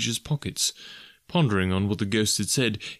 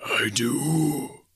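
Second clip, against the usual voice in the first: higher, drawn-out ghostly voice; ghost of Marley; 5 semitones higher than usual. A narrator's reading. The ghost answering Scrooge, hollow spectral intoning.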